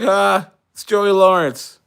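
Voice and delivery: high, loud, drawn-out delight; acted delighted viewer. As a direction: play a happy TV watcher, voice lifted. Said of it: "And you're like".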